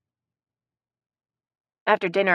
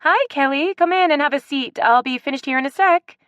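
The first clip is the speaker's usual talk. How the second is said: higher, louder brisk office greeting; business tone; 9 semitones higher, 5 dB louder than usual. A narrator's reading. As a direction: switch to greeting a visitor. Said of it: "Haley's tone switched to business".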